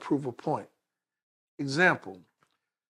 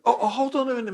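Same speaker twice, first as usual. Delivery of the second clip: calling out; 9 semitones higher, 6 dB louder, faster than usual. Retold or imitated. Official calls them back as leaving.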